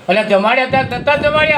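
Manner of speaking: a father rounding on his son, suspicious; father's stern demand. Retold or imitated. Father back home grills son about feeding god, high and demanding.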